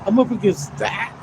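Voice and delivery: high, disbelieving cry of outrage; can't believe what he's up against; incredulous. Retold or imitated. Ranting to his audience about being harassed, incredulous high voice.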